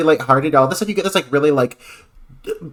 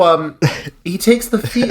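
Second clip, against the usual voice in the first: ordinary talk, nothing marked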